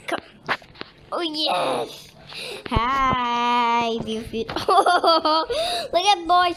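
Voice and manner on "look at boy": laughing. Real, not acted. Laughs at friend's avatar.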